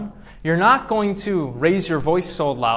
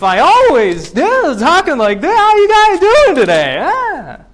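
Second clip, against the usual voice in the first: loud, over-the-top squeal with swooping pitch; silly high voice; 11 semitones higher, 8 dB louder than usual. Shown as a demonstration. Lecturer asking if students would take him seriously, absurdly high-pitched. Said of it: "Would you take me seriously".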